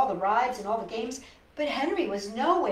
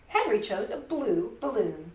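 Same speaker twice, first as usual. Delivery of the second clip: ordinary delivery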